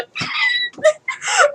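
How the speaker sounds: very high shrieking laugh. Real, not acted. Vlogger shrieking with laughter at her failed cat sound. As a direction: let out a piercing shriek of laughter.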